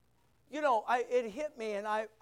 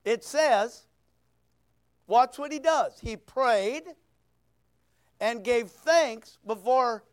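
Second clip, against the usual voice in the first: louder, driving sermon climax; full-force preaching; 3 semitones higher, 8 dB louder than usual. Acted. Pastor rousing the congregation about Daniel's courage, full-voiced preaching. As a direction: preach it out with fire.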